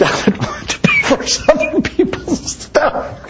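giggling through the words; laughing. Real, not acted. To the congregation, laughing as he tells it. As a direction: laugh it out, amused. Laughing while telling story.

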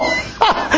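high-pitched laugh; high squeal of laughter. Real, not acted. Telling the church about her gifts, a high laugh. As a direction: let a high laugh break out.

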